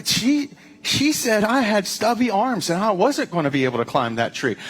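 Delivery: high-pitched mock complaint, dropping at the end; gossiping zoo animal. Chimp complaining to another about an insult, high mock-gossip voice. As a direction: play an offended chimp passing on gossip, starting high. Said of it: "a chimpanzee talking to another one, saying".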